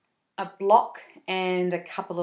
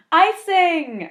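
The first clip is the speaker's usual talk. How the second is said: high loud excited shout; 10 semitones higher, 11 dB louder than usual. Acted. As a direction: announce it with glee. Announcing favourite step.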